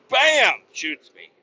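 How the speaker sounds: high, loud, slow gunshot bam; mock gunshot bam. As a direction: blast out the bam like a shot. Retelling getting shot.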